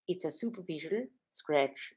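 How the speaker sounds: slow, soft imitation of an Austrian accent. Doctor calling a wound a scratch, in an Austrian accent. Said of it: "in her Austrian accent".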